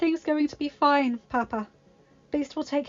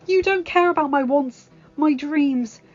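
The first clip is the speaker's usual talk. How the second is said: high, loud and emphatic, slowing on key lines; impassioned rejection; 6 semitones higher, 9 dB louder than usual. Acted. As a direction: tell him off, then declare love. Belle rejects Gaston.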